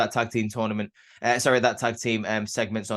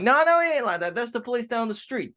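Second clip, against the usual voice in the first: much higher, insistent denial; high-pitched imitation of a wrestler brushing it off; high mock protest; 11 semitones higher than usual. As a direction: pitch it way up, waving it off. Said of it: "And he's like".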